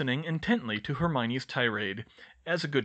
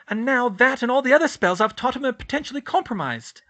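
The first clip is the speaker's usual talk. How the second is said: high, loud, furious student tearing into her headmaster; laying into him; 12 semitones higher, 8 dB louder than usual. A narrator's reading. Student scolding her headmaster, loud furious tirade. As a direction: Hermione blasts Dumbledore, pitched high and loud. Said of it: "she laid into him".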